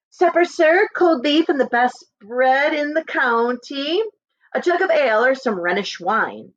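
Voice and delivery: high, bright-eyed innkeeper offer; a lightly voiced eager innkeeper. Innkeeper Janet greeting rich guests, eager and high.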